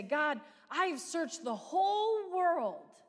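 high, loud, slow cry to God; desperate plea. Acted. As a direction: plead slowly, reaching upward. Preacher voices a desperate prayer.

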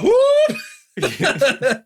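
high loud whoop; mock whoop. Co-host imagining jumping through the fire, high loud whoop. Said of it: "I'd be like".

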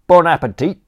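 high, loud cockney manservant offering; chirpy servant barging in with condiments; cheeky butler. Acted. Butler Crawshaw to Aunt Agatha, loud chirpy cockney offer.

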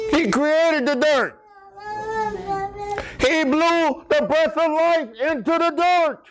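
high-pitched, emphatic pulpit proclamation; full-force preaching. Real, not acted. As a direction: preach each phrase high, pausing between them. Preaching climax about creation.

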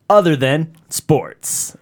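slow, drawn-out title call; show intro. Shown as a demonstration. Co-host testing how to say the show title, slow announcer call. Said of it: "Are we saying".

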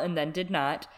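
normal talking voice

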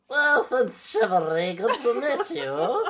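high, loud, silly character voice; exaggerated imitation of a weird nasal greeting; bubble-in-the-throat voice. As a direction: do a hammed-up bad accent with a bubble in the throat. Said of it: "You put a bubble in his throat".

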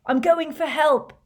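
urgent high, loud shout. A narrator's reading. Harry to the others over howling wind, shouting. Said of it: "he shouted at the others over the howling wind".

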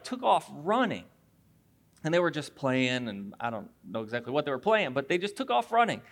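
usual speaking voice

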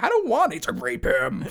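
whiny protest. Retold or imitated. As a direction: plead your refusal loudly. Accomplice protesting.

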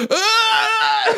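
very high comic crying wail; hammed-up sob breaking into laughter; mock wail. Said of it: "Like, crying so hard".

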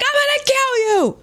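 high, fast furious snarl at the man; angry threat. Retold or imitated. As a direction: spit out the threat in a rage. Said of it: "Scott gets angry".

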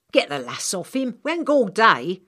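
impatient workman. Acted. Stretcher man to Hawksbill, impatient. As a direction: hurry him along.

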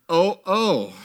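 high, loud dismayed gasp; a hammed-up frightened moan. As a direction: gasp it out like caught men. Brothers gasping in fear.

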